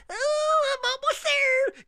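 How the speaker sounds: very high, slow squeaky snail. Retold or imitated. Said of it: "you did a little snail character".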